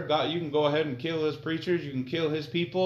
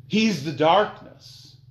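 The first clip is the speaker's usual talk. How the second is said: higher, louder, slow fiery sermon; fiery preaching; 7 semitones higher, 8 dB louder, slower than usual. Acted. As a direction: thunder it at the congregation. Preaching at full force.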